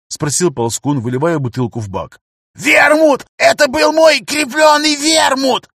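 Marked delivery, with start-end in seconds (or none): 2.5-5.7 shrill high furious shout; yelling. Acted. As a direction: scream it, outraged. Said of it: "продолжает орать старичок". (истошный крик)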